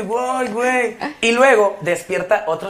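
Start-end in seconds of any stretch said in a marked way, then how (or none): 0.0-1.0 higher, stunned half-laughing "güey, güey"; mock stunned panic. Rapper acting out blanking on stage, mock panic. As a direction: freeze up in mock shock. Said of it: "te quedas así como que".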